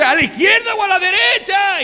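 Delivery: husband yelling which way at the light; confused shout. Retold or imitated. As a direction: yell back, totally lost.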